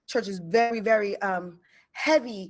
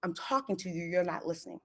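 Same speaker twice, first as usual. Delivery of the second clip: inner voice; 3 semitones lower, 8 dB quieter than usual. Retold or imitated. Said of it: "I hear a voice kinda saying".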